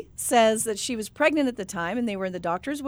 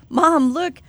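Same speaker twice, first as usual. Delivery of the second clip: lightly voiced kid, louder and higher; four-year-old; 5 semitones higher, 6 dB louder than usual. Four-year-old to mom at family party, lightly voiced child. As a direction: imitate a child pointing something out, loudly. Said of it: "pointed to a little boy ... and said".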